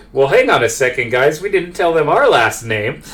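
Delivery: high-pitched protest, lifting toward the end; objecting [name]. Acted. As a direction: pipe up in mild indignation.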